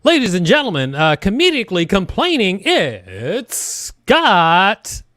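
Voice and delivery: big drawn-out stage introduction, name stretched at the end; show announcer. Real, not acted. Host bringing a comic onto the show, announcer style.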